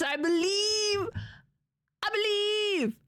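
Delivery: high, drawn-out cry of faith; fervent pleading. Pretend. Willing a game win aloud.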